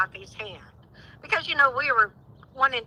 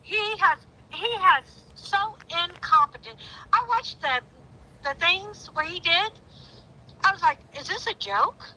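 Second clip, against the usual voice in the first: outraged; 6 semitones higher than usual. Real, not acted. Outraged rant about the sheriff.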